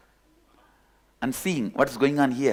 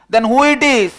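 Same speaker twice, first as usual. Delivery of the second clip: high loud shouted question; 9 semitones higher, 10 dB louder than usual. Acted. Lion shouts back across the distance, puzzled. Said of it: "From there he shouts".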